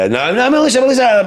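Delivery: high, loud flustered repetition; retold frantic correction. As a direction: scramble to fix the slip, comically. Acts out frantic self-correction.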